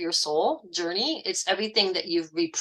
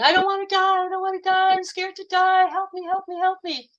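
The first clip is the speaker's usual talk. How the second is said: high, pleading mock wail; imitating a crying woman; 11 semitones higher than usual. Retelling a music video scene, high frightened pleading. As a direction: whimper it like she's terrified to die. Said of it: "so then she's crying".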